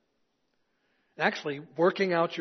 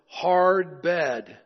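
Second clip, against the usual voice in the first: monk's complaint; 7 dB louder than usual. Acted. Monk's two words joke.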